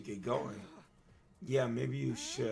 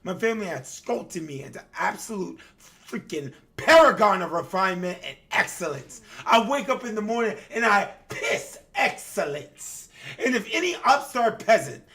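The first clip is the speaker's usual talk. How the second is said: loud, higher, haughty tirade building to a yell; emphatic arrogant rant; 8 semitones higher, 11 dB louder than usual. Acted. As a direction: scream it like a spoiled noble. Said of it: "we gotta put some emphasis on some of this crud".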